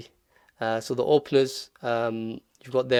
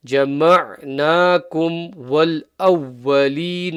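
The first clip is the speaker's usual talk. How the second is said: جَمَعْنَاكُمْ وَالْأَوَّلِينَ recited with the doubled waw held; emphasis on waw. Shown as a demonstration. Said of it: "the emphasis on the waw".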